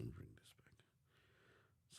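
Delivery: a quick whispered aside. Real, not acted. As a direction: whisper it to yourself while fiddling with the video. Aside while rewinding the clip.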